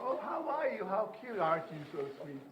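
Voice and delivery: put-on high, sing-song baby talk; lilting parent cooing at a toddler; sing-songy parentese. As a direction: coo it like a doting parent. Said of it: "that sing-songy thing".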